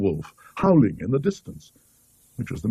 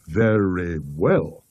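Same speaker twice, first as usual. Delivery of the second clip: a slow, drawn-out, menacing "Very well"; sinister calm; 5 semitones lower, much slower than usual. Acted. Carswell's sinister reply to rebuke.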